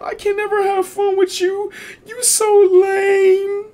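very high, slow, whiny girl voice with a mock cry; whiny sister. Sister sulking at her brother for taking the liquor, high whiny mock cry. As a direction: whine it high like a sulking sister close to tears.

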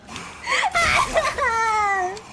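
high panicked shouting laugh; hysterical laugh. Real, not acted. Boss chase, hysterical laugh.

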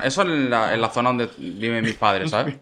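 usual speaking voice

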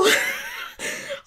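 high-pitched squealing laugh near tears; emotional crying laugh. Real, not acted. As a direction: let out an overwhelmed, tearful squeal. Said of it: "I'm getting really emotional".

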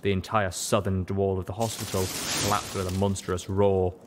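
ordinary delivery

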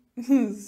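short, loud scornful laugh; mocking laugh. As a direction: burst out with a brief laugh at the captive. Captor mocks crying captive.

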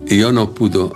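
usual speaking voice

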